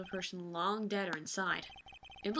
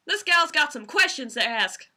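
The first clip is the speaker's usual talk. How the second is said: loud, higher, attempted southern twang; rough southern accent; 6 semitones higher, 12 dB louder than usual. Player testing a voice for Lotta, southern accent. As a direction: try out a big southern US accent. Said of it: "how to do a southern accent".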